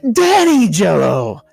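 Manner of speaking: high, loud, gleeful cry; excited host. A narrator's reading. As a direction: shout the name with glee. Host's favourite Jello.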